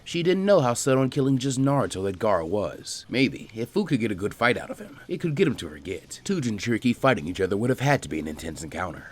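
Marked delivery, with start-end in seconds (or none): none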